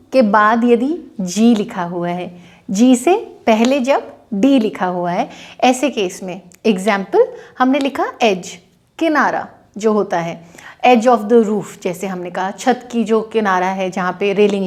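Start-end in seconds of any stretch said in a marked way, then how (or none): none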